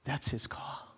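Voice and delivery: hushed whisper. Real, not acted. Preacher hushes the congregation with a whisper. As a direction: let it drop to a breath. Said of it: "whispered closing thought".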